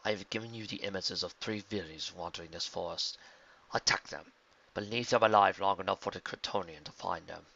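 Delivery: cold calculating schemer; scheming villain. A narrator's reading. Vildrax commands the hydra.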